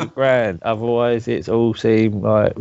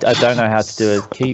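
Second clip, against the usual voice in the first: ordinary talk, nothing marked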